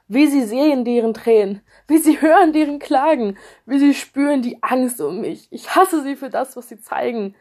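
loud, high furious cry; rasende Wut. Acted. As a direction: spit out your hatred loudly. Rage wanting their tears. (wütend und laut)

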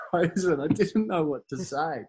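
ordinary talk

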